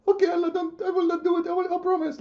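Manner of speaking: high, whiny mock plea; mock pleading Muhammad. Muhammad promising Allah, in a high whiny mock plea. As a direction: beg like a scolded child, pitched high.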